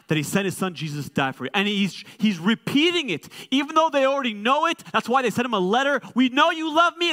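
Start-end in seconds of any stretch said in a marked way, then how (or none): 2.2-7.1 mock insistent. Pastor acts sisters' insistence.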